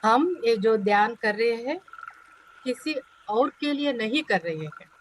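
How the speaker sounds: measured meditation voice, long pauses between phrases; guided meditation. Acted. Teacher leading students into meditation for themselves, guided meditation voice. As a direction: settle them inward, one phrase at a time.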